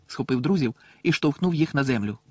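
usual speaking voice

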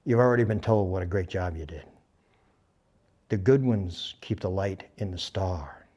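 low and soft, fading to a whisper; quiet mentor's words, sinking into whisper; hushed solemn advice. Retold or imitated. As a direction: impart it like a secret. Charlie's solemn private word retold.

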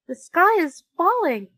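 high, loud cry of alarm; frightened chick's loud squawk; panicked cry. Acted. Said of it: "cried Chicken Licken".

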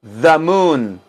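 "the moon" said as thuh moon. Shown as a demonstration. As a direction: open uh vowel in "the" before "moon". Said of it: "se pronuncia the".

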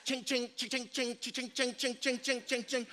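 rapid soft string of chings; quick, even mouth-made Morse code; imitated Morse clicking. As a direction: tap out telegraph noises with the voice.

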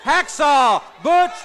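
booming ring announcer voice, stretching out the fighter's name. Acted. Ring announcer introducing the wrestler to the crowd, booming. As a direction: announce the match to the arena crowd.

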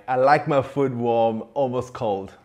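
normal talking voice

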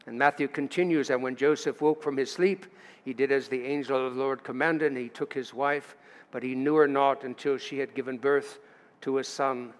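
ordinary talk

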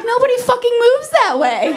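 high, shrill mock mom; exasperated mom. Mom snapping at her kid in the store, high mock exasperation. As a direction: throw it back at the kid, fed up.

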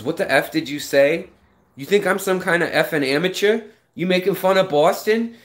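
lightly hammed Boston tough-guy accent. Boston local confronting his friend on the street, mild Boston accent. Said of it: "oh, the thickest Boston accent".

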